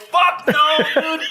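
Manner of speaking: high, strained comic outburst; mock desperation. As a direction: blurt it high through laughter. Acted desperate reaction while waiting.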